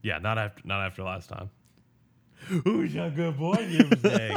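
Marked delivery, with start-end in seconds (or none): 2.6-4.4 high, loud pet talk; talking to a baby. Pretend. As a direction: coo at the dog. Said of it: "that was a bit".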